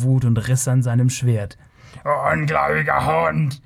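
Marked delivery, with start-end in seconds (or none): 2.0-3.6 hammed-up enraged Kothian voice, no real volume; furious bawl. A narrator's reading. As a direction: curse him in theatrical fury. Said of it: "gröhlte er". (wütend gegrölter Fluch, gespielt)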